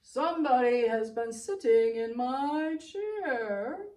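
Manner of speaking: loud, slow Papa Bear voice; a big bear rumbling his complaint. Acted. Papa Bear loudly complains about his eaten porridge. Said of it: "and Papa Bear said".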